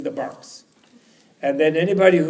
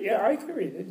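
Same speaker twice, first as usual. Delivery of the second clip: lightly voiced scholar, somewhat raised pitch; scholar's answer; 6 semitones higher than usual. Scholar assures cleaning lady he can read, lightly acted answer. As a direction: answer her as the scholar, a little high.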